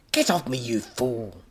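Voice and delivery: slow, angry villain yell; annoyed mad professor shout. Acted. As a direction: shove him off with a yell. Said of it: "Von Stink yelled".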